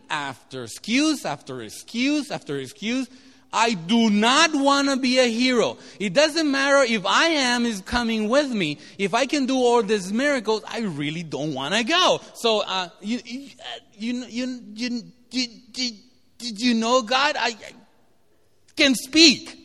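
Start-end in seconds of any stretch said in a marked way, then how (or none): 3.5-12.2 louder, higher, whiny refusal; reluctant Moses. Retold or imitated. Preacher voicing reluctant Moses. 12.3-19.6 stuttering, rising to a loud high burst on can speak; stammering Moses. Retold or imitated. Moses stammering excuses to God.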